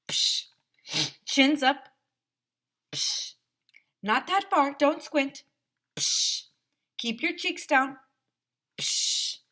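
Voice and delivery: high, loud rapid-fire posing commands; hammed-up diva photographer; bossy photographer. A narrator's reading. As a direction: direct the kids between camera clicks. Photographer barking poses.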